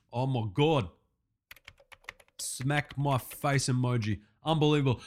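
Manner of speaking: disgusted low muttering to himself; weary disbelief. Acted. Typing disbelief at a crime story.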